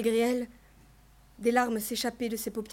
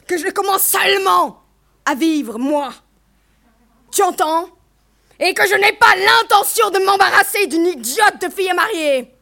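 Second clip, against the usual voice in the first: loud, raised, emphatic tirade; furious outburst; 5 semitones higher, 9 dB louder than usual. A narrator's reading. Mother refusing to have a daughter to marry, furious outburst. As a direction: shout it at her, building in fury.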